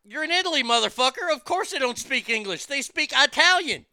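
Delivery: loud, high exasperated rant; exasperated yelling. Real, not acted. Yelling at tourists who expect English in Italy, exasperated shout. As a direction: yell it at the TV in disbelief.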